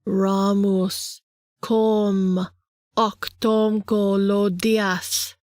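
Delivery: slow, measured spell chant; incantation. Acted. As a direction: intone each word slowly. Reciting a spell to open portal.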